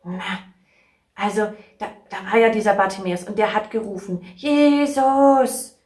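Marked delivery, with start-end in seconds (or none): 4.4-5.8 calling out. Retold or imitated. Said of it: "ganz laut".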